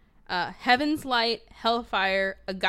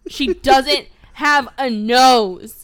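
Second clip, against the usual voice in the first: "She doesn't have a nose" slowly, loudly, word by word; loud incredulous emphasis; 4 semitones higher, 11 dB louder, much slower than usual. Real, not acted. Appalled at the animation, loud slow stress on "doesn't have a nose".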